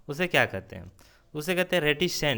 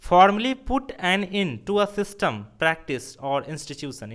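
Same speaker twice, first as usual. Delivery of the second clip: slow dictation; 3 semitones higher, 4 dB louder, much slower than usual. Shown as a demonstration. Teacher reads definition aloud.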